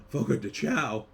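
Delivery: gasp, then say the name in delight; light up at a favorite restaurant; excited gasp. Real, not acted. Host gasps at steakhouse news, excited.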